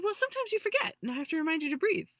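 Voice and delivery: high, eager, fast little helper voice; excited little being. Retold or imitated. Little being eagerly reminding her to breathe, excited and high. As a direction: chirp it out like a thrilled tiny helper. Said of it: "he's, like, super excited".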